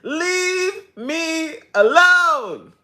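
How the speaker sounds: high, drawn-out begging to be left alone; mock desperate high plea; pleading shout. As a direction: beg the devil to leave you alone. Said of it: "I'm like".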